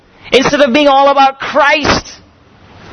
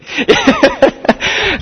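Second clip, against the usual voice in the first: a warm real laugh at the memory; fond chuckle; 9 semitones higher than usual. Real, not acted. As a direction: laugh along fondly at his own story. Laughs after friend's quip.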